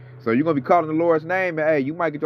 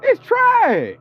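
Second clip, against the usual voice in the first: shouted dismissal; 5 dB louder than usual. Real, not acted. Peak of rant.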